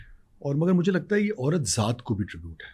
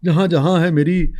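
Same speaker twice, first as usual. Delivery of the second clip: nazm recitation; 4 semitones higher, 7 dB louder than usual. Acted.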